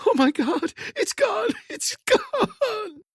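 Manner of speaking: very high, tearful half-laughing cry; sobbing. A narrator's reading. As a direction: sob with relief.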